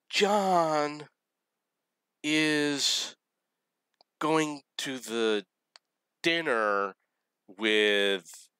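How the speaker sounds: high, drawn-out imitated wail; mock crying. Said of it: "she's crying and crying".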